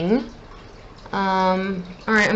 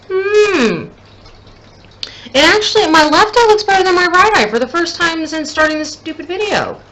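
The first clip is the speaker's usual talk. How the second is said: excited surprise; 8 semitones higher, 5 dB louder than usual. Real, not acted. Pleased her left eye is better.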